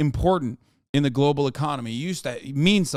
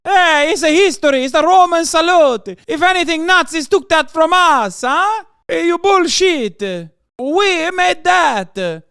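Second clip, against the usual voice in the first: mock Italian accent; 11 semitones higher, 7 dB louder than usual. Italian man claiming the Roman salute: high, loud mock Italian accent. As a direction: defend the salute like an indignant cartoon Italian.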